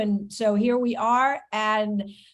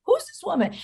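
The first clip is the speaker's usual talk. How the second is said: high-pitched, acted-out puzzlement; mock curious reaction; 14 semitones higher than usual. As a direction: squeal it like you just discovered someone. Acting out own curious reaction.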